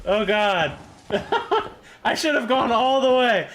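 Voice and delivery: real hype, yelling at the screen; excited yelling. Real, not acted. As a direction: shout it out, thrilled and frantic. Thrilled to get a Dio item.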